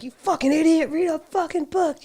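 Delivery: high, sneering yell; drunk angry insult. Retold or imitated. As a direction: spit it at the guy like a furious drunk. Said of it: "he's, like, so pissed".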